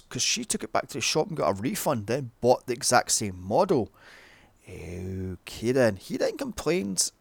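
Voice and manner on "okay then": low, drawn-out dismissal; unimpressed. Real, not acted. Reviewer reacting to a silly film argument, unimpressed. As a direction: draw it out slowly, pitched down, unconvinced.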